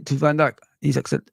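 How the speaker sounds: ordinary talk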